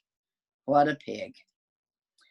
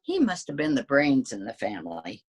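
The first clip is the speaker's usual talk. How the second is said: plain reading voice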